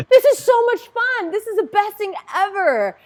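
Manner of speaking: high, loud, overjoyed squeal of words; childlike glee. Retold or imitated. Dyeing Easter eggs with boyfriend, giddy and high. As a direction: gush like a thrilled kid.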